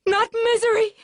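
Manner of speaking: high, loud, anguished wail. A narrator's reading. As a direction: wail out her grief. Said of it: "she wailed".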